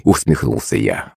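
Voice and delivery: ordinary delivery, nothing marked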